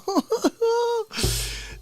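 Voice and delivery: a real high-pitched burst of laughter. Real, not acted. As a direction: crack up at the punchline. Laughing at the clip.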